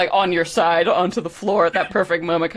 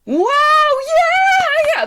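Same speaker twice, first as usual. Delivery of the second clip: very high, loud crowd cheer; imitated audience roar, pitched way up; 6 dB louder, much slower than usual. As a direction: whoop like a whole audience cheering. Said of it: "There's a genuine cheer".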